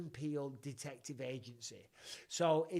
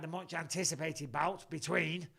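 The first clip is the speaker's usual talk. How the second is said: normal talking voice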